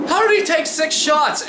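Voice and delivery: high-pitched mock disbelief; incredulous heckler. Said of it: "He's like".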